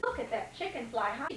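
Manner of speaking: plain reading voice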